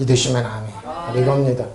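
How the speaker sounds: ordinary talk, nothing marked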